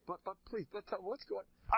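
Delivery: high, stammering insistence; acted pleading. Husband to rabbi about the whispering, acted high pleading. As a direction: beg to be let in on it.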